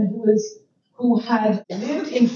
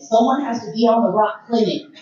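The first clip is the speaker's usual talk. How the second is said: usual speaking voice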